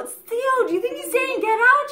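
high-pitched incredulous question; retold alarmed call. Storyteller asks Theo about the bird's words, high alarmed call. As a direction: call out high, checking she heard it too.

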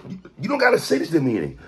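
ordinary talk

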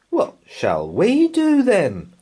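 put-on high, slow whiny child; child's voice. A narrator's reading. Laura asking mum what to do, slow high child. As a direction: drag it out like a bored kid.

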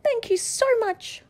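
high, grateful cry; a witch thanking her rescuer; cried. A narrator's reading. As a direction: gush your thanks to the beast. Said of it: "cried the witch".

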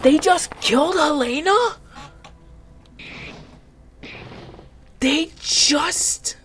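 high, stunned what; can't believe it, voice leaping up; shocked disbelief. Real, not acted. Shocked at character death.

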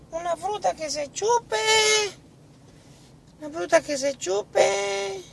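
very high, soft put-on voice; pupil pondering. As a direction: repeat it softly, squeaky, while thinking. José repite la pregunta pensando. (alumno pensativo, vocecita aguda y suave)

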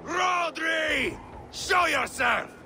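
high, full-voiced battle shout; long, high bellowed summons; shouted challenge. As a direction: call the enemy out across the field. Challenging an enemy at his gate.

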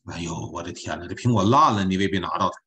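usual speaking voice

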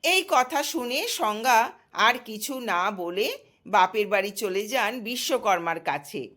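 ordinary delivery, nothing marked

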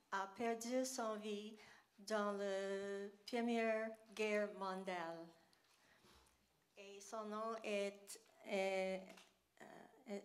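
halting French with an English accent; English speaker struggling through French; broken French. Real, not acted. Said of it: "mon français est pas parfait".

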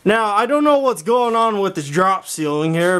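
ordinary delivery

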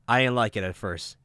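normal talking voice

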